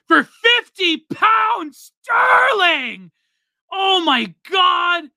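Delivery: high, loud, drawn-out shout of delight; excited shout. Real, not acted. Streamer reading a big donation aloud, thrilled shout. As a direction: stretch the words out, thrilled and yelling.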